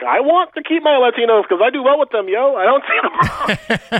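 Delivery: high-pitched imitation of an objecting politician; mock protest. Storyteller playing Lazio refusing to give up voters, mock protest. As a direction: plead the case in a hammed-up high voice. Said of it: "Lazio was just like".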